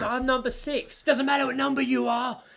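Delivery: high-pitched mock bickering; acted queue squabble. Queuers arguing over their numbers, indignant.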